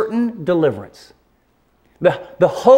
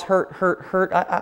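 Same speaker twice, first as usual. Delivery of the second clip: ordinary delivery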